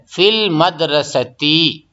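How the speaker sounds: फिल मदरसती said very slowly, final -ti stretched; slow teaching model of फिल मदरसती; kasra ending. Shown as a demonstration. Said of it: "आखिरी वाले हरफ पे जेर आ जाएगा".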